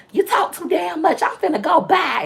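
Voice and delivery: high-pitched exasperated scolding; retold telling-off, high and impatient; mock annoyed great-grandma. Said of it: "she used to get mad".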